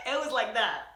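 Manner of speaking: ordinary talk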